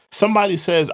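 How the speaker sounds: ordinary talk, nothing marked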